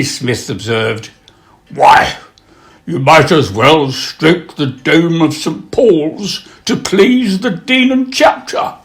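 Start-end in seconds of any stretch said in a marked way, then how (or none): 1.7-8.7 rising high towards the punchline; mock-reasoning retort. Wit answering a child stroking a turtle, mock-reasoning retort. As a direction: deliver the comeback with climbing incredulity.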